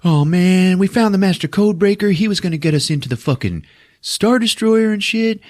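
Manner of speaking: bouncing with a dumb discovery; mock excited heroes. Imitating Finn and Rose bragging about their plan, giddy and high. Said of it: "And they're like".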